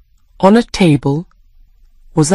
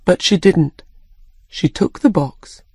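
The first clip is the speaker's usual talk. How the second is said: ordinary delivery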